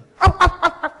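high, loud rapid yaps; poodle yapping. Acted. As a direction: imitate a yappy little dog. Imitates the poodle barking. (נביחות פודל מהירות)

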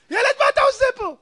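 loud high giant's taunt; Goliath jeers at the boy, shouting; mocking shout. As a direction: bellow down at the little boy.

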